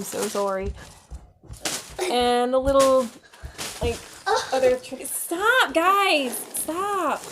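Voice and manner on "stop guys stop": high firm stop. Real, not acted. Yelling at kids.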